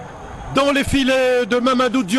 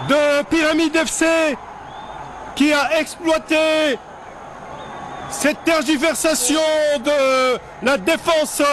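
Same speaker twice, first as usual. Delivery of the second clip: excited sports commentary; 11 semitones higher than usual. Real, not acted. Goal called in live commentary.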